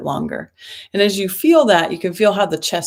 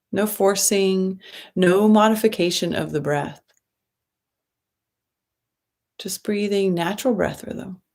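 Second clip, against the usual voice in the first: guided meditation. Acted. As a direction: ease them into the breath, gently and unhurried.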